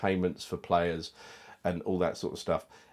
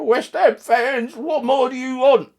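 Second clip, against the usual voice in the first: high, loud mock pundit yell; high shrill mock of Rio yelling down the camera; mocking Rio shout; 10 dB louder, slower than usual. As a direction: ham up a pundit bellowing at fans. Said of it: "who shouted down the camera".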